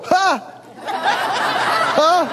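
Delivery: high, pushed-out laugh; forced laugh through pain. Retold or imitated. Preacher showing how he laughed at pain, high forced laughs. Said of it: "I'd go".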